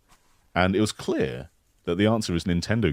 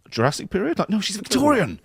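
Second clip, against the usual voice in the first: much higher, mock-incredulous retort; mock exasperation; 13 semitones higher than usual. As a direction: mimic a baffled exchange, exasperated. Mocking the third-party confusion.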